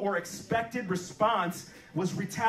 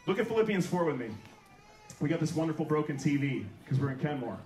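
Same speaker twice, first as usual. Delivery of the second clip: ordinary delivery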